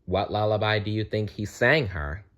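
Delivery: ordinary delivery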